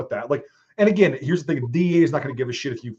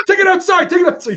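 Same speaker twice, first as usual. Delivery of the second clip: high, loud panicked yell; urgent shouted order to take it outside; 9 dB louder than usual. Retold or imitated. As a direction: yell at him to get it out of the house. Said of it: "And I'm like".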